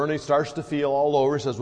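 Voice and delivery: usual speaking voice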